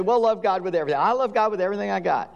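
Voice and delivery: fast, all run together in one breath; lumped together quickly. Shown as a demonstration. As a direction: rush the words as one lump. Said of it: "we kinda lump it together and say".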